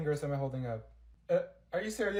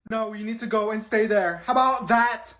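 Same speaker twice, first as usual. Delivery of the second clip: loud and higher, biting the end off; teacher snaps; 6 semitones higher, 9 dB louder than usual. Acted. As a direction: shut the student down loudly. Teacher snaps back at student.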